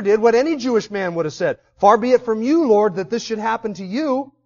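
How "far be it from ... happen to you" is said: louder, raised rebuke; Peter's protest. Retold or imitated. Peter rebukes Jesus that this must not happen, loud. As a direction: rebuke him loudly, refusing it.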